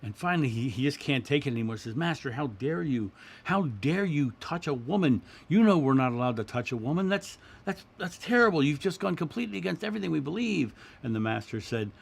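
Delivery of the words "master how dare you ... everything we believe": higher, louder indignant outburst, rising as it goes; angry student. Retold or imitated. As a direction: scold the master in rising outrage. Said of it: "getting angrier and angrier and madder".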